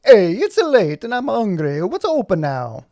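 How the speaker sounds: loud, slow, hammed-up Italian accent; broad cartoon Italian accent, high swoops. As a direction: belt it out in a sing-song Italian accent. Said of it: "Italian accent says".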